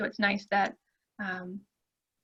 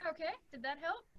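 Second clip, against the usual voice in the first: faint, distant call audio; barely picked up by the mic; far from mic; 3 semitones higher, 22 dB quieter than usual. Real, not acted. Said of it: "It's just very soft".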